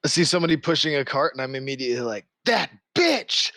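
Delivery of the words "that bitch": higher, louder mock yell; hammed-up angry cry; mock angry outburst. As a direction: snap it out in mock rage. Sees enemy, acted outburst.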